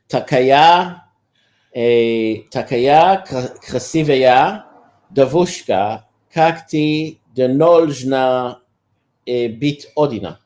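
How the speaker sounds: slow, deliberate Russian, word by word; halting recalled Russian. Shown as a demonstration. Man recalls his Russian come-on line for a friend, slow and halting. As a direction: feel out each Russian word, pausing between them. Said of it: "Let me just try to remember how".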